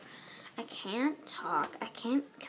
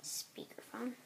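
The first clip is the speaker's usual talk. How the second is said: breathy whispered aside; 2 semitones higher, 11 dB quieter than usual. Real, not acted. Player whispering the menu option to herself. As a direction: whisper the word to yourself. Reading game option aloud.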